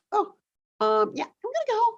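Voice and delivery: high, sing-song oh okay; awkward chirpy retreat; mock polite brush-off. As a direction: squeak it out like a polite escape. Retold own awkward exit.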